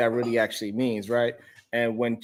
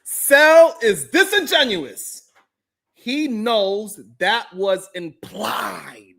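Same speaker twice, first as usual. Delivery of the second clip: dramatic slow reading; 7 semitones higher, 4 dB louder, much slower than usual. Acted. Reading super chat.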